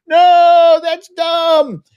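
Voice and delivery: high, very loud drawn-out shout; screaming at the screen. Acted. Host acts out a viewer warning horror characters, screaming at the screen. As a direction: yell it like a frustrated movie watcher. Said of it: "you're screaming at the screen".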